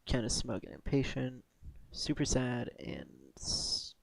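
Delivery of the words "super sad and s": ordinary talk, nothing marked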